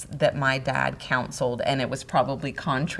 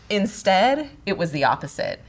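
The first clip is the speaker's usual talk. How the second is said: normal talking voice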